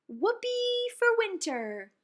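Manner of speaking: high, loud, drawn-out whoopee; big cheer. Acted. Kids cheering for winter, exuberant. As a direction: celebrate with all your heart.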